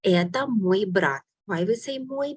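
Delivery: ordinary talk, nothing marked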